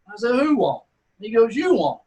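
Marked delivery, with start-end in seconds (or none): 0.4-0.8 higher, louder "Who won't?" thrown back; cocky challenge. Retold or imitated. Taking the second dare. 1.6-2.0 teasing dare. Retold or imitated. Driver repeating the dare.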